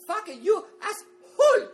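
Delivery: put-on high, loud shrieked curse in a cute Japanese accent; mock Japanese swearing. Imitating his ex-girlfriend swearing at him in a high shriek. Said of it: "cute little Japanese accent".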